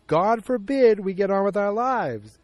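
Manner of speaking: high, loud, drawn-out sarcasm; acted exasperation. Man to Joyce about moving on, exasperated. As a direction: lean hard and slowly into the complaint.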